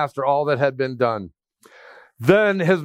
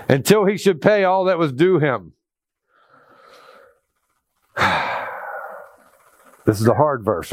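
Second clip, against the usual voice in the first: usual speaking voice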